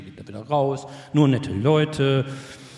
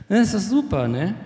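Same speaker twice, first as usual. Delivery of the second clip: breezy mock reassurance; 7 semitones higher than usual. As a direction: play someone waving away every worry, stretching the words. Beschwichtigende innere Stimme, gespielt. (gespielt lässig beschwichtigend)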